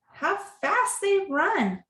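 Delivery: plain reading voice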